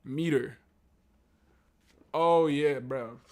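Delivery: normal talking voice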